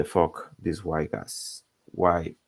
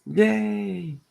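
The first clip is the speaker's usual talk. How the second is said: mock cheer; 12 semitones higher than usual. Mock celebration of the heroes.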